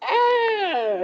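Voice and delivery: high-pitched drawn-out fake cry; mock wail. Player attempting the witness's outcry, mock wail. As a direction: wail it high, half-hearted.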